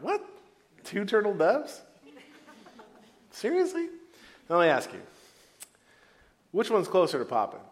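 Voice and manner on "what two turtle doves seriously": higher, scoffing "Seriously?"; can't believe the tiny gift; incredulous. Retold or imitated. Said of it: "they're like".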